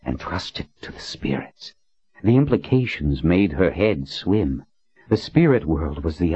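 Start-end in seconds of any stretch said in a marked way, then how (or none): 0.1-1.7 breathy whispered thought; whispering. A narrator's reading. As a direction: whisper it to yourself. Her inner thought.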